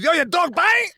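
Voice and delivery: high, loud holler; mock shouting neighbor; imitated yell. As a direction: call out the question across the street. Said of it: "He yells at me".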